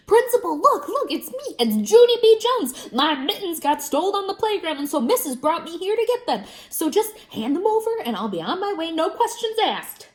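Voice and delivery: high, loud eager calling out; a girl thrilled to see the principal; excited calling. A narrator's reading. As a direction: bounce with excitement as you call.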